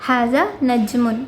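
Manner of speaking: slow, careful Arabic reading; slow teacher reading; slow model sentence. Shown as a demonstration. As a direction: say it slowly for learners. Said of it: "നമ്മുടെ question".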